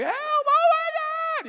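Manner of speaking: very high, loud, drawn-out panicked scream; shrill hammed-up cry for help; screaming kid. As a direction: wail for help like a drowning eleven-year-old. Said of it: "You know, I'm screaming".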